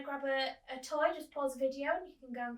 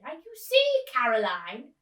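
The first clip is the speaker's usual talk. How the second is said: put-on old actress voice; 3 semitones higher, 12 dB louder than usual. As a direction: gush like a grand old actress. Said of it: "Miss Spink said".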